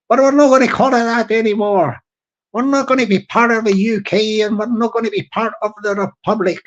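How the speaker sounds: put-on Irish brogue, canvassing for votes; hammy Irish political hopeful; Irish accent. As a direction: make a mock campaign pitch in Irish. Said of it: "I can do the Irish voice".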